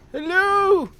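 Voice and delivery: loud, high, bright-eyed shout of hello; excited greeting. Real, not acted. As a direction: call it out like spotting a friend. Greeting viewer by name.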